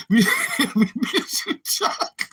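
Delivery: words broken up by real laughs; laughter swallowing the end of the sentence; helpless laughing. Real, not acted. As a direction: try to speak while cracking up. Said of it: "I can't stop laughing".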